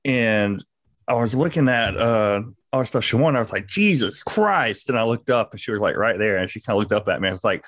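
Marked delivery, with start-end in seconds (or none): 3.7-4.9 drawn-out, higher-pitched cry of disbelief; retold exasperated exclamation. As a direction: stretch it out slowly as an exasperated oath.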